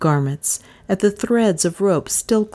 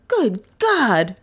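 high, startled exclamation; abrupt cry; 6 semitones higher than usual. A narrator's reading. Beresford is struck by something flying, crying out. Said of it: "cried Beresford abruptly".

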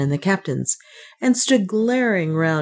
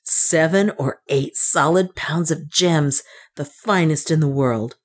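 plain reading voice

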